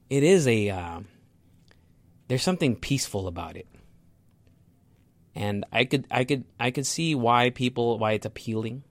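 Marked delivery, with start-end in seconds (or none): none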